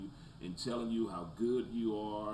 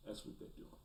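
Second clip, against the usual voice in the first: whispered; 14 dB quieter, faster than usual. Real, not acted. Trailing repeated dismissal.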